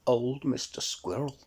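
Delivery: usual speaking voice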